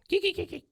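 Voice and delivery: very high, repeated dolphin-like squeaks; imitated dolphin squeak. Imitating the bed squeaking like a dolphin, high squeaks. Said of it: "it sounds like a dolphin".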